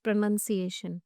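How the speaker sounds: slow, careful "pronunciation" with nun; correct pronunciation. Shown as a demonstration. Teacher gives students the right word, slow "pronunciation" with nun. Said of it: "Right word is".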